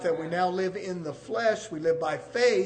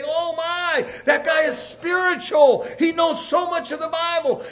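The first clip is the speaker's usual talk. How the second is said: higher-pitched imitation of an impressed churchgoer; mock admiration; 8 semitones higher, 5 dB louder than usual. Preacher imitating someone impressed by Bible knowledge, mock admiration. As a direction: gush in awe, as a preacher imitating.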